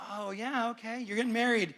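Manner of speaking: sarcastic to a friend marrying again; sarcastic reply. Retold or imitated. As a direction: feign surprise, dripping with irony.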